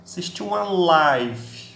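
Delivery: slow, live said "laiv"; noun live as laiv. Shown as a demonstration. Teacher repeating slowly for the class: live as "laiv". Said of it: "tem uma pronúncia diferente".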